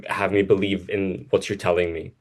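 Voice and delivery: ordinary talk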